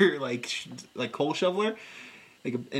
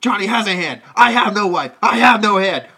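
louder, hammed-up anguished outburst; mock rant; 3 semitones higher, 9 dB louder than usual. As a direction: bellow the grievance theatrically. Cage's character ranting about brother.

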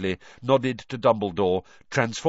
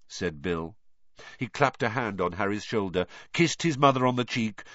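plain reading voice

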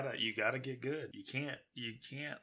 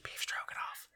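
whispering; 3 dB louder than usual. Pretend. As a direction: ghostly whisper right in your ear. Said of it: "whispering in your ears, a ghost".

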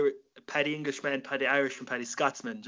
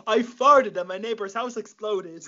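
high and loud, cracking up through it; slow, barely holding in the laugh; laughing punchline; 8 semitones higher, 5 dB louder than usual. Retold or imitated. Telling the Paddy joke to the guest, laughing through the punchline.

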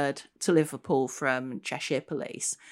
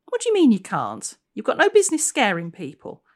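higher, louder indignant retort; 7 semitones higher, 8 dB louder than usual. Acted. Constable scolding the ghost for scaring people, indignant. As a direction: tell her off for scaring people.

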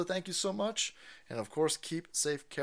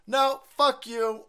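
mock defiance; 9 semitones higher, 5 dB louder, slower than usual. Acts defying the demons.

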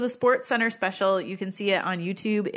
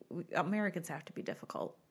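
fast whispered aside; 3 semitones lower, 9 dB quieter, faster than usual. Real, not acted. Whispered aside, mock complaint.

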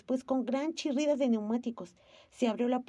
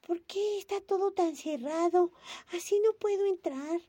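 put-on high, plaintive girl voice; a little girl shut out; child Momo; 9 semitones higher than usual. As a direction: Momo pleading to the tortoise. Momo at the locked door.

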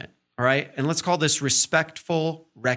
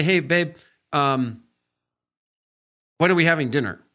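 polite asking; 4 dB louder than usual. Shown as a demonstration. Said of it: "if I ask in a certain tone".

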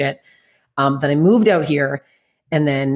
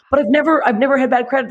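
much higher, pleading protest; 8 semitones higher than usual. Retold or imitated. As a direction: plead your innocence up high. Pleading with bank clerk.

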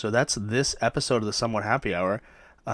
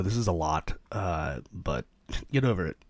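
ordinary talk, nothing marked

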